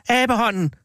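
narrator's contained version of a wild scream; wild cry. A narrator's reading. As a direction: cry it out high, falling at the end. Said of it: "skreg hun vildt".

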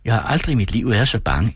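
usual speaking voice

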